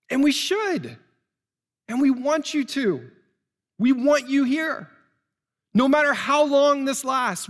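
rally the congregation with open arms; fervent preaching. Acted. Preaching at full force.

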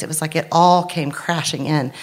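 normal talking voice

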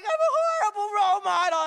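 loud, high-pitched retold bawling; mock crying wail. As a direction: wail it out, hammed-up and tearful. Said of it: "And I'm really crying".

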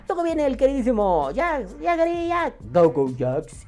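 nombre anunciado en agudo, como en la radio; preséntalo como estrella de lucha; anunciador. Acted. Presenta al oyente con voz de anunciador. (voz de anunciador exagerada)

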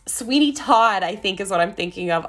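ordinary delivery

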